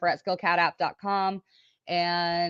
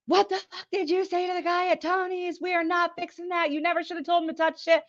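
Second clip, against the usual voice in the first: high-pitched mock outrage; losing his shit; 11 semitones higher than usual. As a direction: imitate a furious telling-off, pitched way up. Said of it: "lost his shit on me".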